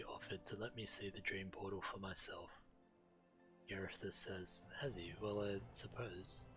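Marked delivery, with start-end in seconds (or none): none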